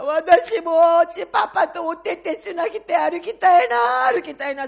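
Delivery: 泣きじゃくる幼い娘の声、高く大きく; sobbing little girl. Retold or imitated. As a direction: wail it like a three-year-old in tears. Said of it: "大泣きしながら". (父を恋しがって大泣きする娘の真似)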